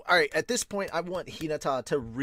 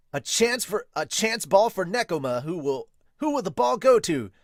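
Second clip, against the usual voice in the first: higher, louder play-by-play; excited sports commentary; announcer call; 7 semitones higher, 6 dB louder than usual. Acted. Match announcer voice.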